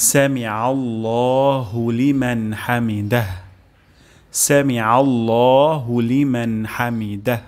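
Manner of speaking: very slow, every syllable drawn out; slow recitation. Shown as a demonstration. Teacher slowly reciting the phrase for the class. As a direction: recite it slowly for students to copy. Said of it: "তাহলে আমরা উচ্চারণ করছি".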